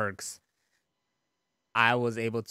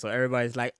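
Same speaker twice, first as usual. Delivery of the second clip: usual speaking voice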